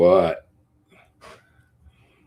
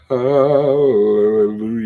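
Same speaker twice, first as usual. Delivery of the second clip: drawn-out hallelujah; 4 semitones higher, 6 dB louder, much slower than usual. Pretend. Mock praise, drawn out.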